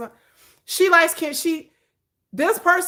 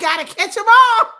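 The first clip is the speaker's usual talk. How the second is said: mock cheer; 8 semitones higher, 8 dB louder than usual. Friend quoting Pokemon.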